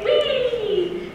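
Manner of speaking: high, loud squeal of joy; Henriette's wee. Retold or imitated. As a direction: squeal it like a delighted old lady. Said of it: "she would always say".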